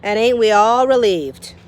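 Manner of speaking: loud, sour cook; sarcastic Cook. Acted. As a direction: drip sarcasm like a bossy cook. Cook's voice in the story.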